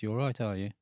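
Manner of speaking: quick, broad Brummie accent; Birmingham accent. Shown as a demonstration. Guest showing how he'd sound from Birmingham, in a Brummie accent. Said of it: "if I was talking like I was from Birmingham".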